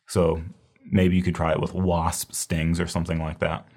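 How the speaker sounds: normal talking voice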